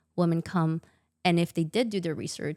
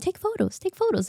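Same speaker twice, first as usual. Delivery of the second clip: mock urgent staff; 12 semitones higher than usual. Staff urging husband to take photos.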